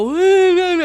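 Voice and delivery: high, loud mock kid wail; imitated whining. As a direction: imitate children whining in a drawn-out howl. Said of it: "and start whining".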